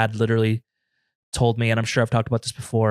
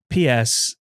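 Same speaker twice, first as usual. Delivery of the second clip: normal talking voice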